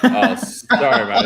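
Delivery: high, slow, laughing apology. Real, not acted. Caught out by the water joke, laughing apology. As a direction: giggle the apology out. Laughs at his own pun.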